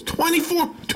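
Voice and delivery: twenty-four shot up high in disbelief; incredulous. Real, not acted. Host amazed by Canada's snow rule, incredulous. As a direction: say the number like you can't believe it.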